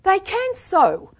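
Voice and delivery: high, loud, drawn-out insistence; stubborn contradiction. A narrator's reading. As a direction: contradict like a defiant child.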